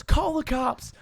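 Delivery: high-pitched imitation of a yell; mock yell. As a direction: imitate an agitated teammate yelling.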